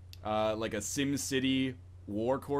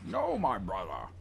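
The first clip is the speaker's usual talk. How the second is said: Bane impression; 5 semitones lower, slower than usual. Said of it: "like, Bane".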